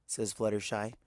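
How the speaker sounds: ordinary delivery, nothing marked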